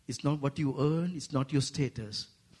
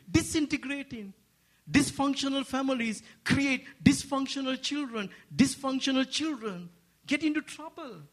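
full-force preaching; 9 semitones higher than usual. Acted. Preacher urging the church to reclaim families, fervent high preaching. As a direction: preach it out to the whole congregation.

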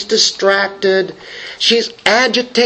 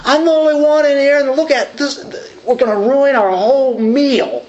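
flustered Martha; 6 semitones higher than usual. Retold or imitated. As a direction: fret about the burning bread, pitched up. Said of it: "she could have been saying".

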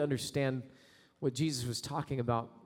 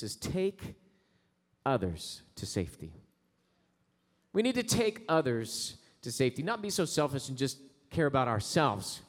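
ordinary delivery

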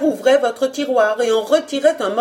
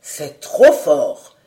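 slow, low, louder indignant cry; indignant outburst; 5 semitones lower, 6 dB louder, much slower than usual. Acted. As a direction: burst out in outrage. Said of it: "s'écriait au comble de l'indignation". (cri indigné, grave et lent)

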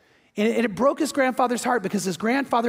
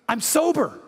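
higher-pitched acted amazement; astonished; 6 semitones higher than usual. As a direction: exclaim it like a startling discovery. Drunk man suddenly finds himself sober.

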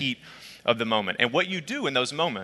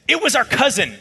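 high, indignant accusation; acted-out outrage, voice shooting up; outraged; 11 semitones higher, 4 dB louder than usual. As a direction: imitate a scandalized sister dropping the bombshell. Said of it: "she was absolutely outraged".

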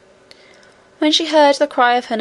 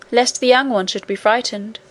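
ordinary delivery, nothing marked

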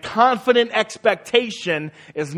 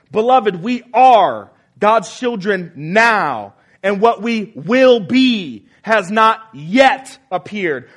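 louder, slow, every word driven home; forceful reading; 3 semitones higher, 5 dB louder, slower than usual. Shown as a demonstration. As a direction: proclaim the verse with full force, slowly. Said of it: "notice the force behind these statements".

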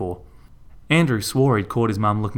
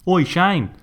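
higher, louder call across the paddock; 9 semitones higher, 4 dB louder than usual. Acted. Andrew calling to Shane riding in the paddock, loud call. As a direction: hail a friend from afar. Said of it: "Andrew cried out".

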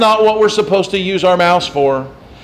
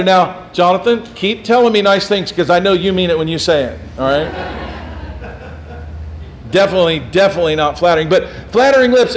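ordinary talk

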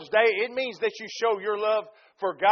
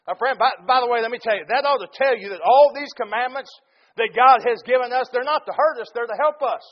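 ordinary talk

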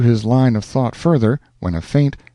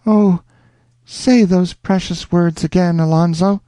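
higher, longing heroine voice; Rosanna's voice; 8 semitones higher than usual. A narrator's reading. Rosanna begging Alonzo over the telephone, longing. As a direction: plead sweetly. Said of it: "a faint sound came".

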